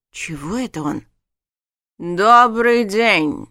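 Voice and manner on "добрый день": slow, loud, booming hello; stern official greeting. A narrator's reading. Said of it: "Немного не кстати произнёс маг".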